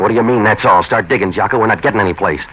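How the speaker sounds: loud, impatient prodding of his informant; impatient push. Acted. Novak pushing his informant.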